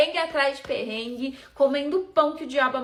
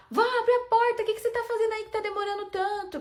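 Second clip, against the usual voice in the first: grito agudo e aflito pela porta; gritando em pânico; 10 semitones higher than usual. Retold or imitated. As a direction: chamando a avó desesperada, rápido no final. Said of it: "viu eu gritando com a minha avó". (grito em pânico para a avó trancada no banheiro)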